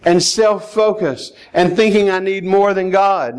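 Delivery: ordinary talk, nothing marked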